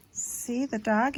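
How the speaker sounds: usual speaking voice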